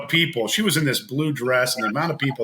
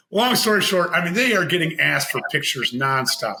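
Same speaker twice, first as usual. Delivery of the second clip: ordinary delivery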